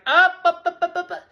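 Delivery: high chanted up up up; mock cheer. Comedian mock-cheering about Toronto, high chant.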